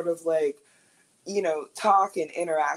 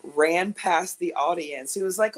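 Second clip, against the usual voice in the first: usual speaking voice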